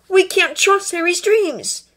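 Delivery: high, slow protest; yelled. A narrator's reading. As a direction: yell it out, pitched high and stretched. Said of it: "Draco yelled".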